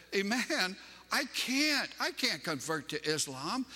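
high-pitched, earnest retold protest; pained refusal. Preacher retelling his answer to a sobbing friend, pained refusal. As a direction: plead that you simply cannot.

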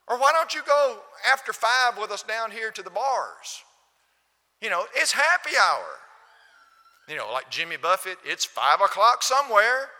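loud, high coaxing invitation to the bars; tempting worldly friend. Retold or imitated. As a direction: lure them out with mock cheer. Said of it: "That's the way the world talks".

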